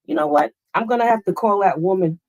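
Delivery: ordinary delivery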